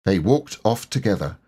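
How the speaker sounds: plain reading voice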